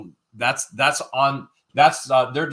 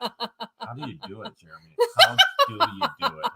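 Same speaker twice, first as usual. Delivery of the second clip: imitating a nurse; 11 semitones higher than usual. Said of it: "she was like".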